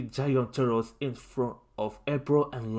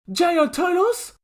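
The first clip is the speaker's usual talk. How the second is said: high, slow astonished question; can't believe your eyes; panicked disbelief; 7 dB louder than usual. A narrator's reading. Lincoln panicking at seeing giant turtles.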